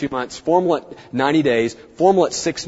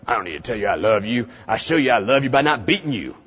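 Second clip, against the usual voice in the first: gruff silent-generation dad; 4 semitones lower, 3 dB quieter than usual. Retold or imitated. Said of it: "your classic silent generation".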